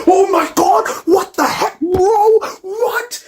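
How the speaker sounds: high-pitched fanboy freak-out; hammed-up starstruck panic. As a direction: squeal in mock disbelief. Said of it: "I'd start freaking out".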